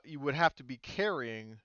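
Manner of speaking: normal talking voice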